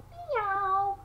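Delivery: high sung-out meows; high kitten meows for a toddler; cat meow. Acted. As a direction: mew like a playful kitten. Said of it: "And the cat says".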